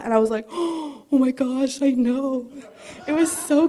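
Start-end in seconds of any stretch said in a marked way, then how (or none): 0.5-2.5 slow, drawn-out awed "oh my gosh" with a little laugh; breathy delighted surprise with a laugh; amazed gasp. Retold or imitated. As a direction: sound stunned that you know it. Gasps at remembering the verse.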